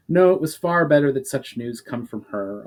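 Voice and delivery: ordinary delivery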